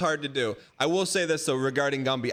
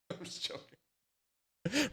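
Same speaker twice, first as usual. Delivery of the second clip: much softer, slow laughing take-back; soft chuckling I'm just joking; laughing softly; 12 dB quieter, much slower than usual. Real, not acted. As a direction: giggle it out quietly.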